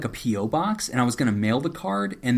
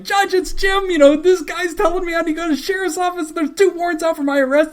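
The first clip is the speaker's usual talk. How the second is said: high, loud frantic retelling; urgent, high-pitched appeal for help; desperate plea; 7 dB louder than usual. Retold or imitated. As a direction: blurt it out in a hammed-up panic. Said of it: "At a moment of desperation".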